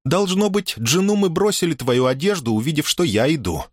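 put-on high innocent girl's voice; feigned innocence. A narrator's reading. Дая продолжает невинную игру. (невинный высокий голосок)

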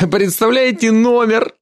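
giggling rhetorical question; drunken laugh. Acted. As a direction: ask it while laughing drunkenly. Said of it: "Хаас засмеялся".

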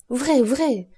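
pressing, anguished order; anguished cry. A narrator's reading. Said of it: "s'écria Villefort".

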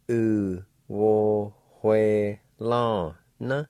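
U wo hue la na stretched out very slowly; slow demo. Shown as a demonstration. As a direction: drag every syllable of U wo hue la na. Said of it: "like this".

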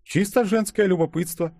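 high, light female voice; offhand woman. A narrator's reading. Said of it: "пожала плечами Ёжик". (небрежно, высоким женским голосом)

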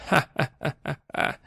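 soft hissed ha-ha-ha, creeping; a shadow's whispery cackle; hissing laugh. A narrator's reading. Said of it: "зашипела тень павшего бога".

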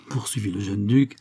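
usual speaking voice

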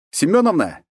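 shouted call across the yard. Acted. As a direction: call out loudly to the cook. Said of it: "крикнул я".

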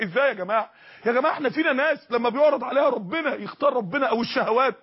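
usual speaking voice

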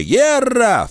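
high, triumphant shout; jubilant war cry. A narrator's reading. Pekin runs up shouting, imitating the caller. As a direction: yell it like the caller. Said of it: "выкрикивая... в подражании голосу".

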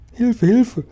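high frantic cry for help; panicked little ladybird cry. A narrator's reading. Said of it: "schrie Marienkäferchen".

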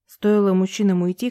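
ordinary delivery, nothing marked

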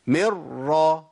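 مر with the n gone, turned into a doubled r; firm doubled r, no trace of nun; full assimilation. Shown as a demonstration. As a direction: merge straight into the r of مر, no hum. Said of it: "بحرف مماثل للحرف الثاني".

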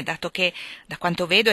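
usual speaking voice

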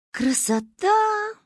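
slow high drawn-out "Красота". Acted. Girl admires the town, drawing the word out. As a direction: stretch the word out dreamily. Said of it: "протянула я".

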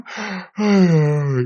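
long acted yawn; put-on sleepy yawn; imitated yawn. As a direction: let out a big falling yawn. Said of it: "cái bà ngáp".

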